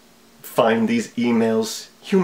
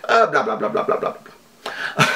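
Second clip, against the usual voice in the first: villain muttering. Pretend. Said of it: "That's how you say the address".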